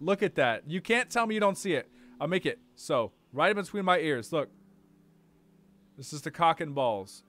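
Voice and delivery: ordinary delivery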